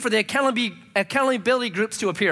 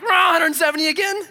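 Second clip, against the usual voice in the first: higher, louder roar of a reckless driver; wild shout; 8 semitones higher, 5 dB louder than usual. Retold or imitated. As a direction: roar it like peeling out.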